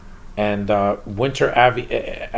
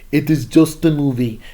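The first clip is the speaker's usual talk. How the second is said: mock Russian-accented Superman, a bit higher and louder; Jason Isaacs Russian accent; 4 semitones higher, 4 dB louder than usual. Reviewer mocking the Superman voice actor, in a put-on Russian accent. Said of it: "That's how he sounds for the whole freaking movie".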